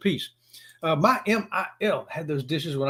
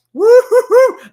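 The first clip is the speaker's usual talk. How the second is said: high, loud laughing whoop; joyful whoop; whooping cheer; 13 dB louder, much slower than usual. Real, not acted. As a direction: let out a big celebratory woo. Cheering the race win.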